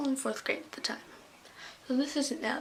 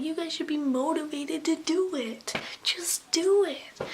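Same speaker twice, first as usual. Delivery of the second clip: higher, emphatic mock scolding; imitating yelling teacher; 5 semitones higher, 4 dB louder, slower than usual. Teacher lecturing kids about jump rope, mock yell. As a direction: push it into a drawn-out mock yell at the end. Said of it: "if you keep yelling at us".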